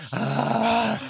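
high, breathy gasp; mock gasp. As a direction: imitate a dramatic gasp for air. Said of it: "gasping for breath the whole time".

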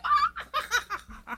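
very high mock laughter; imitated laughing fit. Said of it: "having a laughing fit".

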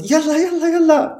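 very high, excited sports-fan chant; cheering on. Shown as a demonstration. Watching a basketball player about to score, cheering. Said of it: "Sí, como para apoyar".

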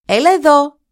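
a loud, high call to come here; shouting. Acted. Boy calls the bear out to fight, shouting. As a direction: challenge him at full voice. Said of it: "του φώναξε".

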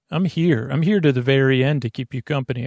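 usual speaking voice

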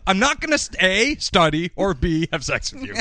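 mock dismissal. Brandon rejecting Andrea, high mock voice. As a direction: list it off in a high mocking voice. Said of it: "he's like".

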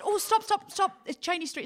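high, urgent call to the driver; calling out to stop the taxi. Retold or imitated. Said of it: "And I said".

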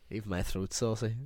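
ordinary talk

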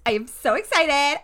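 high, loud, keen burst; real excitement. Real, not acted. Co-host telling listeners her real feeling, excited. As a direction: say it like you truly mean it. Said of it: "I actually am really fucking excited".

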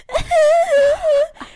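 high, loud fake wail; dramatic sob. Girl mocks herself crying on forest floor with a loud wail. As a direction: howl like a melodramatic teen thrown on the ground. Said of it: "som en liten tönt".

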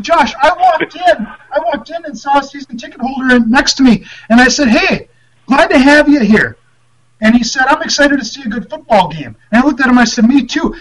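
high, heated mock rant; imitating ranting coach. Coach rants to a reporter about a fan, high and heated. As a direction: vent like a grumpy old coach.

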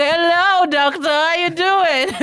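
loud, slow, high cheeky greeting; cheeky newborn. Pretend. Newborn greeting the doctor, loud and cheeky. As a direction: greet the doctor like a show host.

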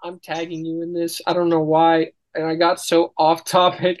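ordinary talk

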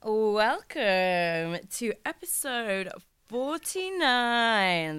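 drawn-out show intro, words stretched and swooping upward; high-energy greeting. Real, not acted. Podcaster welcomes listeners to a new episode, big drawn-out energy. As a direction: stretch every word into a big slow welcome. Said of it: "That was some energy".

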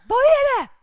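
loud, high snapped question; exasperated shout. Retold or imitated. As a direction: snap back at the screaming kid. Parent answering kids impatiently.

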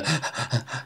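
quick, shallow gasps, imitated; hyperventilating gasps. Shown as a demonstration. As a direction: mimic gasping from a cold-water plunge. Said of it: "I actually did a few hyperventilation".

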